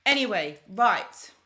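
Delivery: normal talking voice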